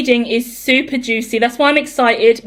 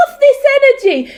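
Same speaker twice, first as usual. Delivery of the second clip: much higher, louder burst of excitement; squealing with delight; hyped squeal; 13 semitones higher, 4 dB louder than usual. Real, not acted. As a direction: shriek with joy at the energy. Hyped start of reading.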